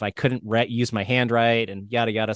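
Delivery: usual speaking voice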